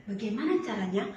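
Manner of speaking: soft, low wondering to herself; pensive inner thought. Acted. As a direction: think it over quietly, puzzled. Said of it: "lalu dia berpikir". (gumam pelan penuh tanya)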